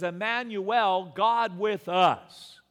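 slow booming declaration; emphatic proclamation. Acted. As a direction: lay down Emmanuel God with us. Preacher stretches key words.

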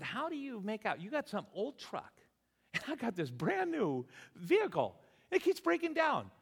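high-pitched incredulous complaint; exasperated disbelief. Acted. Retold exasperated challenge to friend.

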